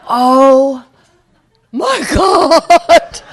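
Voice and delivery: high, loud laughing cry of dismay. Retold or imitated. Driver laughing in horror at four flat tires. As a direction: groan out the disaster while laughing.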